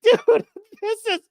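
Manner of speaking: laughing high and loud in disbelief; giggling dude. Real, not acted. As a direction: crack up as you say it.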